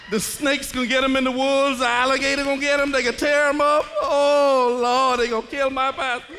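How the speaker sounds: loud, high, hammed-up fretting; mock panic. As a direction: build to a wailed, comic lament. Acting out his panicked thoughts.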